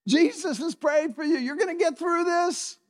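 "You're gonna get through this" sung out high and bright; encouraging promise. Shown as a demonstration. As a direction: lift them up with confident hope. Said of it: "I could look at some of you and say".

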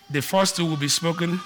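ordinary delivery

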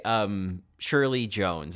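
usual speaking voice

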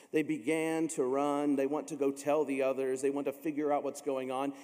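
usual speaking voice

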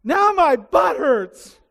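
put-on high, loud, slow whiny child voice; imitating his son. Son Jared after a spanking, mock high whiny complaint. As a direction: whine it like a spanked little boy. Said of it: "the first thing he says is".

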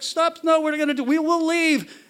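high, loud begging; retold panicked pleading. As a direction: imitate a boy desperate to leave. Frightened boy pleading to leave.

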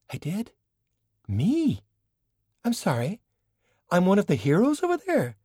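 high, incredulous delight at being a hero; a woman thrilled to hear she was a hero, high and amazed. Acted. China hears she led the resistance, high delighted disbelief.

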